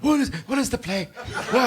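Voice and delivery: high, laughing befuddled ham; confused old actor. Retold or imitated. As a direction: squeak it like a lost old actor. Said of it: "And he goes".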